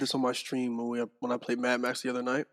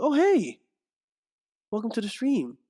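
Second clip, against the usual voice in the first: much higher, louder welcome; bright streamer greeting; 10 semitones higher, 4 dB louder than usual. Acted. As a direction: greet the chat with a big lift. Greeting new stream viewers.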